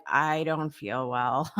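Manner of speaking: low, slow, soft mock-poorly voice; imitating feeling unwell. Host retelling her guest's call before the show, mock-unwell. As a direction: drag it out quietly, as if feeling rough. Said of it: "feeling pretty rough".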